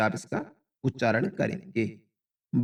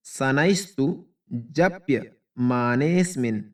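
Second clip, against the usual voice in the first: slow word reading; slower than usual. Shown as a demonstration. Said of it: "अभी इसको लयबद्ध कर लेते हैं".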